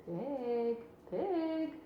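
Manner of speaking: soft, kind farmer coaxing. A narrator's reading. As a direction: gently call the pig. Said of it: "in a kind voice".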